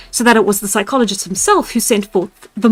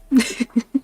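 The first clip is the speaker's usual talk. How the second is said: laughing; 4 dB quieter than usual. Real, not acted. Broke the model, laughing.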